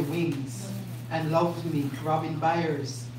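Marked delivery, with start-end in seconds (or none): none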